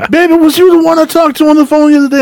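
put-on very high, quick older-woman voice; old-school older lady. Customer asking worker about the phone call, old-school lady voice.

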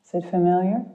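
calm guide easing listeners inward. Acted. Leads a guided meditation.

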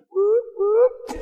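two high, playful whoops. Acted. Preacher celebrating to the congregation, high whooping.

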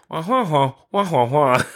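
high Charlie Brown teacher wah-wahs; muffled wah wah talk. Retold or imitated. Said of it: "I hear the Charlie Brown".

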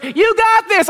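high mock pep talk; imitated parents' encouragement. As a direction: mimic parents cheering from the sidelines. Parents cheering dad on, voiced.

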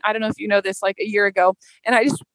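usual speaking voice

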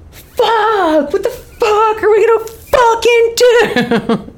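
very high, loud mock outburst, drawn out; hammed-up panicked yell, dragging out the swearing; Aiden freaking out. Imitating a TV boyfriend finally freaking out over moving plans, hammed-up yell. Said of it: "and then he goes".

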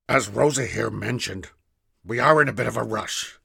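a hint of a polite old man; lightly voiced old man. A narrator's reading. Ash's character voice.

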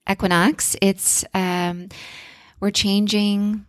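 ordinary delivery

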